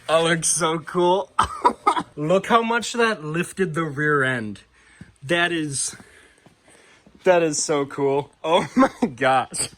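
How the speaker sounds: slow, giggly amazement; delighted laughing. Real, not acted. Vlogger sees how much the tires lifted the truck, laughing in delight. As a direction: drag the words out and break into laughter.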